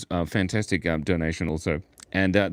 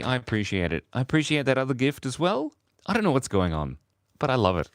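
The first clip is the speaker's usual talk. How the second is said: ordinary talk, nothing marked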